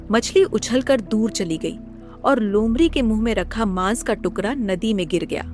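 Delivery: ordinary delivery, nothing marked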